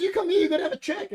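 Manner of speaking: high-pitched mock command; imitated official order. Official stopping George for a check.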